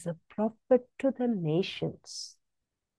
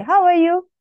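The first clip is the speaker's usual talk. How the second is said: cheery greeting; 9 semitones higher, 5 dB louder than usual. Pretend. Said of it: "That's why I say".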